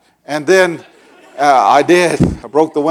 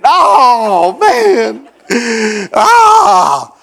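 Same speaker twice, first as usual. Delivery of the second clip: high, loud rueful wail; laughing groan; 8 semitones higher, 6 dB louder, much slower than usual. Retold or imitated. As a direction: moan at your own folly. Blinded, groaning at himself.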